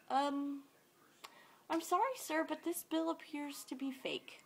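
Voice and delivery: high, careful customer-service voice; tentative, polite cashier telling bad news; polite apology. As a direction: raise the pitch and soften it to let a customer down.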